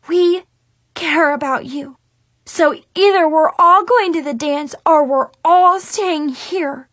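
ordinary delivery, nothing marked

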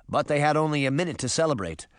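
ordinary delivery

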